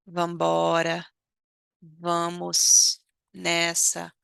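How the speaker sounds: slow, spelled-out vambora; slow model. Shown as a demonstration. Teacher slowly modelling vamos nessa for class.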